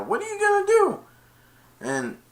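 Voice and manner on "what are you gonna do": high squeak. Retold or imitated. Child voicing genie line.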